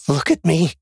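high, urgent plea; rising panic. A narrator's reading. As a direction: demand attention as panic climbs. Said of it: "panic rising".

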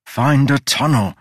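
a touch of a frantic scream. A narrator's reading. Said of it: "he screamed at himself".

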